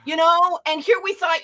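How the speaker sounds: host gushing over the performer, mock awe. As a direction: gush over the talent, hammed-up. Mock awed praise.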